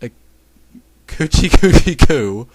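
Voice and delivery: put-on baby-talk teasing. A narrator's reading. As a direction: coo it slowly like tickling a baby. Character tickles someone like a baby.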